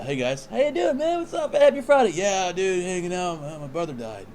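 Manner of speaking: high, chirpy mock small talk, tailing down at the end; mock-casual bar greeting sliding into bad news; breezily conversational. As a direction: greet them high and casual, then drop it in offhandedly. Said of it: "Just very conversational".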